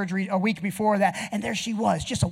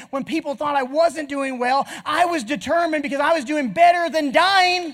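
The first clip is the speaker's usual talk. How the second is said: louder, higher fiery exhortation; preaching at full force; 7 semitones higher, 5 dB louder than usual. Acted. Preacher urges congregation to keep moving, fiery and loud. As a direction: drive the congregation forward.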